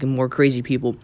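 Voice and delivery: ordinary delivery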